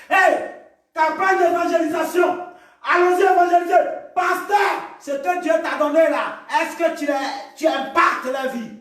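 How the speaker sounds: high, urgent preaching shout; rally the church at full voice; shouted exhortation. Real, not acted. (exhortation criée de prédicateur)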